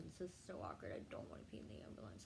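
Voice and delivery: whispered, fast, mortified aside; a secret whisper to herself in the ambulance; embarrassed whisper. Retold or imitated. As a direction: hush it like nobody should hear. Embarrassed inner thought in ambulance.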